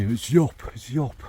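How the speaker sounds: "Yop" said as yop, mimicking odd pronouncers; mock weird pronunciation. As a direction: mockingly say "Yop" with a y sound. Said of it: "pronounce dupe in an over weird way".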